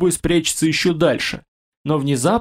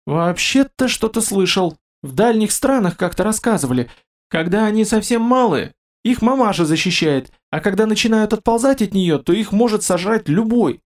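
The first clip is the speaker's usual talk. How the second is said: mumbling merchant; 7 semitones higher than usual. A narrator's reading. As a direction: mumble it nervously, pitched up. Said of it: "Промямлил".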